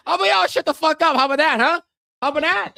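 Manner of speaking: high-pitched, rushed comeback at chat; indignant, high-voiced retort; mock-indignant clapback. Real, not acted.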